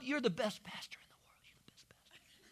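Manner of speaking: fawning whisper fading away; mock adoring whisper; whispered flattery. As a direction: breathe the praise out softly.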